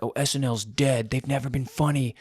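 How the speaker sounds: lightly voiced dismissive complainers; imitated naysayers. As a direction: mimic people writing something off. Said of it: "people have been saying".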